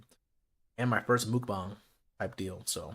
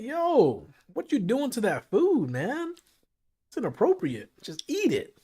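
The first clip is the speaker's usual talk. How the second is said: much higher, drawn-out mock outrage; mock indignant protest; 10 semitones higher, 3 dB louder, slower than usual. Streamer telling break-room chewers off, mock indignant. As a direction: call out a noisy eater in an exaggerated high voice. Acts out scolding noisy eaters.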